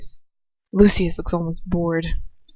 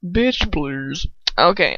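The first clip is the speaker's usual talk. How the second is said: plain reading voice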